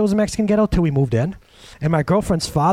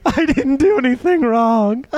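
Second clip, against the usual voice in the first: high, slow plaintive wail of a hurt man; a child's hurt plea, lightly imitated; retold sobbing; 9 semitones higher, 3 dB louder, slower than usual. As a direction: replay the breakdown in a stretched, mock-sobbing whine. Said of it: "sobbing like a child".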